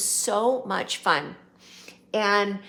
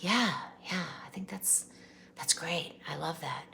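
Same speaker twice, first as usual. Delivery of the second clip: imitating [name]'s whisper; 6 dB quieter than usual.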